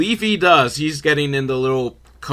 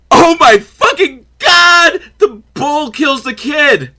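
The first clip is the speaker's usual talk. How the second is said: real slow horrified shout, much higher and louder; shocked outcry; 10 semitones higher, 6 dB louder, slower than usual. Real, not acted. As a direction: cry out in disbelief. Shocked by the film's twist.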